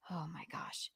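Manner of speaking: horrified whisper. Real, not acted. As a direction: breathe it out in disbelief. Aghast at the dress.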